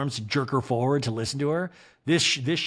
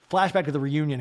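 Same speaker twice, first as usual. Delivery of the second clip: usual speaking voice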